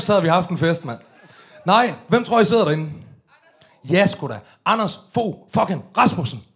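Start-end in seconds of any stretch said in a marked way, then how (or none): none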